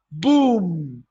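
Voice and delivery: loud, drawn-out BOOM; booming sound-effect word; onomatopoeic boom. Shown as a demonstration. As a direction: make BOOM sound like the blast itself. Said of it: "the same sound".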